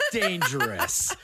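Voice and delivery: usual speaking voice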